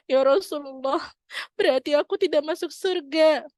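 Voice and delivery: mock-crying lament; crying grandmother. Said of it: "Terus nenek itu menangis".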